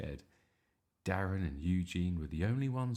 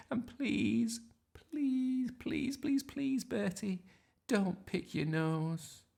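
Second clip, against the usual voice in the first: higher, slow begging; pleading; much slower than usual. Acted. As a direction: beg him again and again, rising. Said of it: "pleaded Mum".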